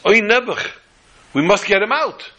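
put-on an old man's "oy" of sympathy; pitying exclamation. Skinny man voiced in the tale.

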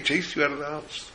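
normal talking voice

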